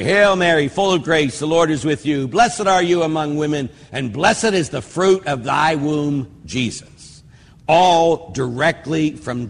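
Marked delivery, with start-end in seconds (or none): 0.0-7.2 the Hail Mary recited aloud with devotion; prayer recitation. Shown as a demonstration. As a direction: pray it for the congregation. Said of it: "the Hail Mary".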